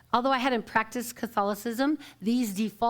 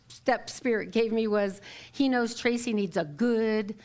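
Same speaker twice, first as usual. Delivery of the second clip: ordinary talk, nothing marked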